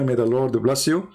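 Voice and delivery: usual speaking voice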